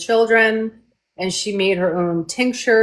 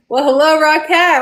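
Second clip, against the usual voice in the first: higher and louder happy hello; excited greeting; 8 semitones higher, 6 dB louder than usual. Real, not acted. Host greets a dragon sister joining the chat, thrilled. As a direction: gush a big welcome. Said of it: "Oh my God".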